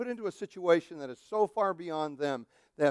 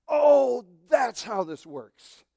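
ordinary talk, nothing marked

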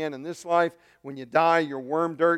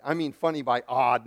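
ordinary talk, nothing marked

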